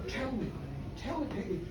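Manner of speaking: hushed and intimate; soft, earnest asking. Acted. Toby asks Peggy as they walk, softly. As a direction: ask her quietly, almost under the breath. Man softly questions woman at dawn.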